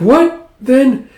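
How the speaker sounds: high, loud surprise; acted shock, pitch shooting up; shocked question. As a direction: blurt it in disbelief. Eriko reacts to revelation.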